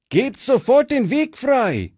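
Fritz shouting. Acted. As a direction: order the bandits aside at full voice. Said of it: "rief Fritz".

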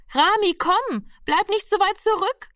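high-pitched call after a straggler. A narrator's reading. Nurse calls the boy who lags behind, calling out. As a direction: call the child back, voice pitched right up. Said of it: "rief die Schwester schon wieder".

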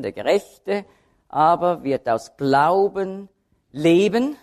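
"Gerechte", "Glauben" and "leben" each accented, split by pauses; slowly weight "Gerechte", "Glauben", then "leben" alone; three separate accents. Shown as a demonstration. Preacher showing the three key terms, separate stress on "Gerechte", "Glauben", "leben". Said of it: "die Akzente liegen auf den drei Begriffen".

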